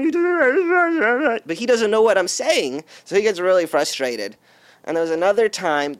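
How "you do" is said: high squeaky nerd voice; nervous nerd pleading; nerdy voice. Retold or imitated. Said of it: "really nerdy voice".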